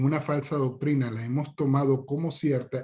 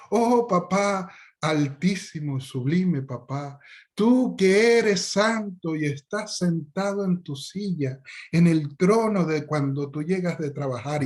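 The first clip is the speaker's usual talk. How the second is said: slow, higher, hammed-up prayer to papa; mock worshipping child; 4 semitones higher than usual. Child prays to his father for money, slow mock reverence. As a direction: drag out the praise like a pious child.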